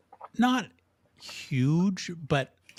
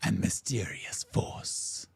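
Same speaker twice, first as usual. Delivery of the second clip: ominous teaser, hushed at the end; announcer's dramatic tone. Acted. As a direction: stretch out the mystery. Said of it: "his tone change. He goes".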